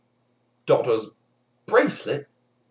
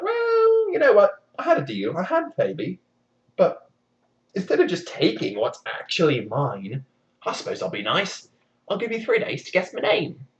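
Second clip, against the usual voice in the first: lightly voiced imp; 7 semitones higher than usual. Rumpelstiltskin's offer.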